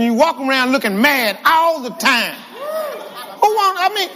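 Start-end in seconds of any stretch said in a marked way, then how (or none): 2.3-4.1 laughing. Real, not acted. Laughing mid-sentence.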